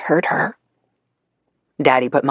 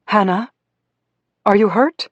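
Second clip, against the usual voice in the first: concerned Mommy; 6 semitones higher than usual. Acted. Mommy notices injured daughter.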